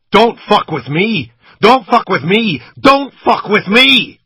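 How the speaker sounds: slow, high, frantic screaming, building; a gunman losing control over his victim. A narrator's reading. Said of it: "He sounded out of control".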